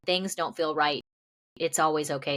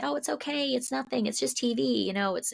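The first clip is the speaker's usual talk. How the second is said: lighter, higher-pitched brush-off; breezy dismissal; 9 semitones higher than usual. Retold or imitated. Imitating people who excuse compromise on TV, breezily dismissive. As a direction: wave it away in a high, easy voice.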